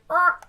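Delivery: mock cat yowl, loud and high; cat growl imitation. Imitating the cat when it lands badly, a startled growl. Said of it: "and went".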